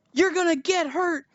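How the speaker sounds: high-pitched taunting bandit voice; mock threatening bandit.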